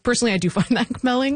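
high-pitched, laughing through the words. Real, not acted. Guest admits to host she finds that compelling, laughing. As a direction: let a laugh break into the admission. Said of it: "I don't know why I'm laughing when I say that".

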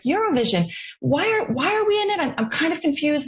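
high-pitched, mock-baffled questioning; confused reaction. Commentator imitating Canadians' first reaction to the Eurovision news, mock confused. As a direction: imitate a puzzled public, pitch climbing sharply. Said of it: "their first reaction across Canada was like".

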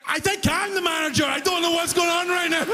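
high, near-shouted helpless confession; panicked confession. Retold or imitated. Worker tells the customer he might be the manager, high panic. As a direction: blurt it out, totally lost.

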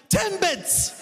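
high, clipped repeat of a single word; impatient customer. Retold or imitated. Annoyed customer repeating the word to the clueless cashier, high and impatient. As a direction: say it like it should be obvious.

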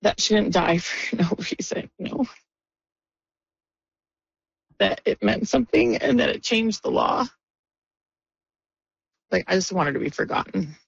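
real tears, halting with long pauses; tearful. Real, not acted. Grieving friend explaining why the law matters, tearful. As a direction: push the words out through tears, haltingly. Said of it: "sorry, I'm gonna cry now".

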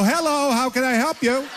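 chirpy mock receptionist greeting; answering a call. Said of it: "And then I go".

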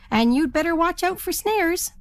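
a hint of a fussy hen; lightly voiced hen. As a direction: warn the turkey kindly. Hen warns turkey.

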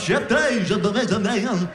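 high, drawn-out garbled gibberish; backwards-record babble. Retold or imitated. Comic imitates wife pointing at stuff, high garbled tongues. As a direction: babble like a record played in reverse. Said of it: "Sounds like you're turning a record backwards".

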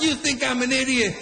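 high-pitched mock indignation; other duck retorting. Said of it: "the other one's like".

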